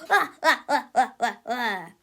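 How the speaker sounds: loud mad-scientist laugh; evil cackle. Acted. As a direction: cackle like a cartoon villain. Melvin's villain laugh.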